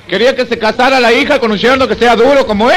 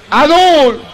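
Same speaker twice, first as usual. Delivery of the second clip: calling out; 4 semitones higher than usual. Acted. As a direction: shout the name into the dark. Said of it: "Grita Adul".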